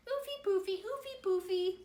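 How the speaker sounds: high, loud cheeky piggy; piggy's high reply. A narrator's reading. To her brother, the piggy refuses, high and loud. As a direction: squeal it defiantly, then a loud high nope. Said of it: "But the piggy answered".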